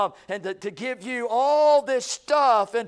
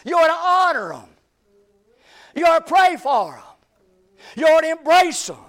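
higher and louder, a fervent sermon climax; preaching at full force; 7 semitones higher, 8 dB louder than usual. Acted. Preacher urging his congregation to honor their pastor, fervent full-force preaching. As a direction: shout it out to the church, rising with every line.

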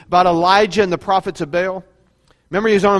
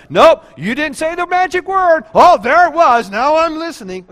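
higher and louder playful put-on; mock God voice; 10 semitones higher, 8 dB louder than usual. Preacher mocking the idea of magic words, playful God voice. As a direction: play God waiting for the password. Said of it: "It's not like God's like".

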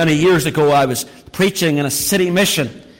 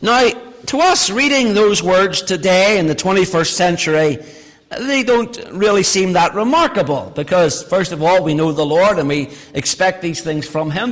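ordinary talk

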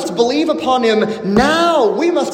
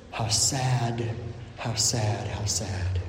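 sorrowful lament; 13 semitones lower, 12 dB quieter, slower than usual. Acted. Preacher lamenting, voice sinking.

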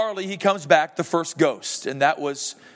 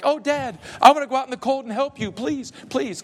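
put-on higher, fast eager kid voice; imitating his son; 8 semitones higher than usual. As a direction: beg like an excited child. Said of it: "He's like".

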